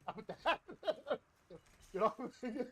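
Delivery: high laughing teasing. Real, not acted. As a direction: laugh through the words. Laughing while teasing teammate.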